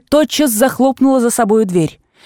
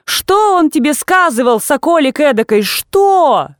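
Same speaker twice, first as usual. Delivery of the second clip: indignant demand; 6 semitones higher, slower than usual. Acted. Aunt demands answer.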